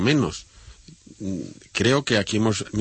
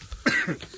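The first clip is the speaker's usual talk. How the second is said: coughing fit; 4 dB quieter than usual. Real, not acted. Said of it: "en medio de un ataque de tos".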